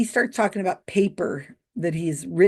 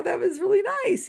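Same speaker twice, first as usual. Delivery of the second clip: mock polite praise; 10 semitones higher than usual. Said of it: "in the seats are like".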